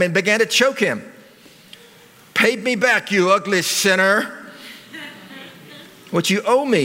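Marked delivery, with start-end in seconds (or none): 2.3-6.8 higher, harsh demand; bully him for the money; demanding servant. Acted. Servant choking his debtor, higher mock demand. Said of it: "he demanded".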